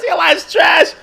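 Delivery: high, loud, laughing squeal. Real, not acted. Streamer mocking his own hairline with a high laughing squeal.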